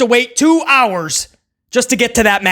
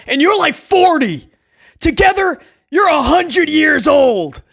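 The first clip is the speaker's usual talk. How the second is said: quoting the wrestler; 8 semitones higher than usual. Retold or imitated. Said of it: "and he goes".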